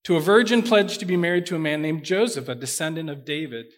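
measured, expressive Bible reading to the congregation; scripture reading. Acted. Pastor reading the annunciation to the church, solemn and expressive. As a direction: read the passage aloud with weight, voicing the angel fuller. Pastor reads Luke passage aloud.

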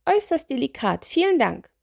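high, pleased little witch. Acted. Witch tastes the sausage, high pleased voice. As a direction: savour it in a high voice.

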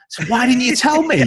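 high, loud mock indignation; indignant outburst. Mock outraged reply to friends.

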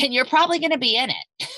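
high, loud, quick jab; retold cheeky zinger. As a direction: toss it out fast in a high, mock-cheery voice. Retelling her zinger to the family.

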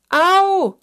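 high, very loud yelp; echoing squawk of pain; pained cry. A narrator's reading. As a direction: holler as if trodden on. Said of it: "schrie das Schneehuhn".

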